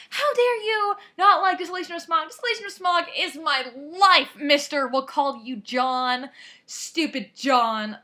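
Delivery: loud, high indignant mock outburst at a classmate; hammed-up fan rage, loud and high, easing off at the end; mock yelling. Fan mock yelling at a boy who disliked the movie.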